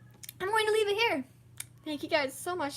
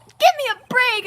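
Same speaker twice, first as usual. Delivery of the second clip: exasperated shout; 8 semitones higher, 12 dB louder than usual. Acted. Character protests in game dialogue.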